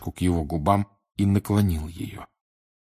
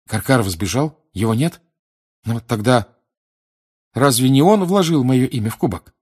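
higher, louder as it builds; alarmed bewilderment; 5 semitones higher, 6 dB louder than usual. Acted. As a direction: question it with growing disbelief. Boy bewildered, mounting alarm. (растерянно, с нарастающей тревогой)

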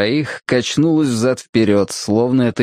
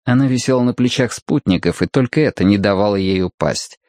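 usual speaking voice

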